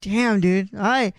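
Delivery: ordinary delivery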